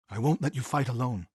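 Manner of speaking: ordinary talk, nothing marked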